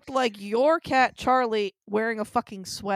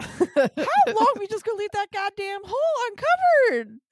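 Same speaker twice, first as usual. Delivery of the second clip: incredulous; 9 semitones higher than usual. Real, not acted. Roommate worried about hole.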